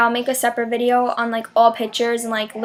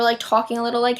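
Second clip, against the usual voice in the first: normal talking voice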